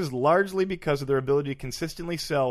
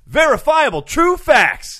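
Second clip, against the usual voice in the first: loud, high announcer shout; segment announcer; 7 semitones higher, 8 dB louder, slower than usual. Pretend. Host announcing a new segment, loud announcer voice. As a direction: punch out the segment title.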